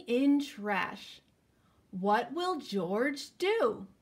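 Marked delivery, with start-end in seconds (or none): none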